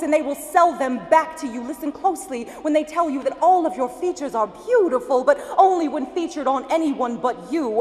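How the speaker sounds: impassioned, rhythmic slam poetry delivery; spoken-word poem. Acted. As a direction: recite it as a driving poem, rising in force.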